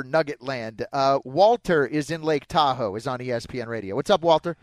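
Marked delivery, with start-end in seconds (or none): none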